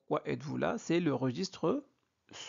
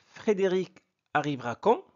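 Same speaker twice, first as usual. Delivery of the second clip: teacher's model question, pitched up to end on "quand"; questioning intonation; 4 semitones higher than usual. Shown as a demonstration. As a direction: voice lifted on final "quand". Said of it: "on doit changer l'intonation".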